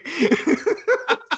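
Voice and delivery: high-pitched cackling laugh; high burst of laughter. Real, not acted. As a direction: break into a squealing laugh. Laughing at the story.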